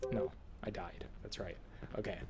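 quiet whisper to himself. Real, not acted. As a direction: whisper it, resigned.

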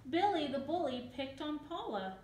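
ordinary delivery

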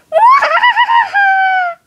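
high shrieking evil laugh; evil cackle. Pretend. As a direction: cackle like a villain. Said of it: "Wow, you sound weird".

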